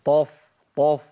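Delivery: طف with a thick emphatic sound; heavy f as ta. Shown as a demonstration. Teacher isolates the wrong heavy f. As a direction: hit طف dark and heavy. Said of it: "لا، ما ينفعش".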